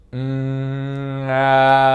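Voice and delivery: a held hum opening into a loud, ringing ah; vocal expansion hum. Shown as a demonstration. Trainer demonstrating to the audience a loud vocal expansion ah. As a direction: let the voice fill the room from the belly. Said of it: "vous allez faire ceci".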